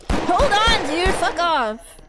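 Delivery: sudden high, loud cry of alarm; startled yelp. Real, not acted. Caught off guard by a monster, a startled yelp. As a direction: jolt up in pitch as something jumps out.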